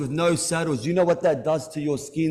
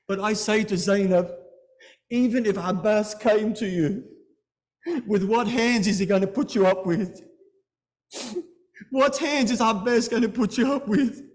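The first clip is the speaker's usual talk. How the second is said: higher, pleading cry; rising lament; 4 semitones higher than usual. Real, not acted. Preacher to Zainab, rising anguished plea. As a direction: climb into anguish.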